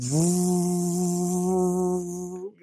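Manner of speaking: drawn-out, level "vrooo" that fades away; computer fan whir. Retold or imitated. Podcaster editing a clip on his computer, imitated fan whir. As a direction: hold a humming fan noise on one note.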